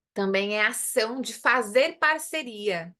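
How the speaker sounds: ordinary talk, nothing marked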